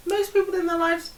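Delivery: high, loud, laughing jab; playful laughing retort; laughing tease. Real, not acted. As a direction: push back while cracking up. Teasing retort while laughing.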